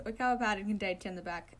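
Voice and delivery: ordinary delivery, nothing marked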